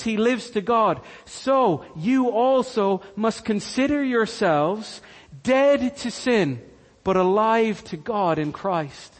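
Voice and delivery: ordinary talk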